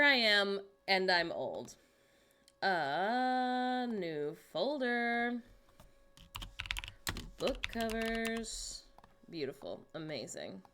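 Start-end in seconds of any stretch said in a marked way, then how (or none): 2.6-8.8 drawn out. Real, not acted. Typing aloud, stretched.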